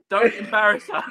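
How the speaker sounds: host laughing while echoing the fan's plea; laughing quote. Retold or imitated. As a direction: quote the line while cracking up. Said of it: "just laughing to myself".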